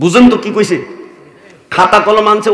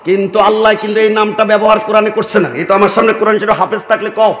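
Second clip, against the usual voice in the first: ordinary talk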